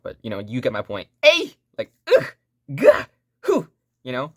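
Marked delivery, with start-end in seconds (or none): 1.2-1.5 high, loud sword-swing yell; anime battle cry. Retold or imitated. Teacher imitating female game character, high loud cry. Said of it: "female character kinda swings their sword". 2.1-3.7 short loud effort noises; imitated fighting grunts. Teacher imitating anime combat sounds, loud effort grunts. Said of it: "swings their sword and they're going".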